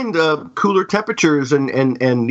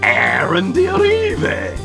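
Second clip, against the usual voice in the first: slow, theatrical spooky host voice; horror host; 2 semitones higher than usual. Acted. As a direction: drawl it like a creepy crypt keeper. Halloween horror host intro.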